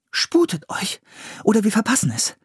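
high-pitched, pressing urgency; urging. A narrator's reading. Priscilla urges the others not to miss it, urgent. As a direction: hurry them along in a high voice. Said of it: "drängte Priscilla".

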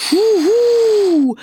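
very high, loud hooting huhu; owl hoot. A narrator's reading. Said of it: "machte der Uhu".

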